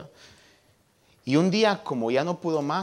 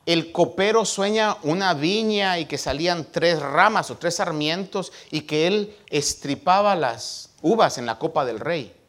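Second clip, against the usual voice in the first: ordinary delivery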